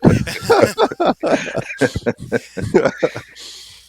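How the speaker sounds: long, loud, high belly laugh; hearty laugh. Real, not acted. Game master cracking up at a player's joke, hearty laughter. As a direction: burst into prolonged real laughter.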